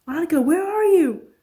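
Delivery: high, loud searching call. Acted. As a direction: shout across the water to find her. Searching, calling out.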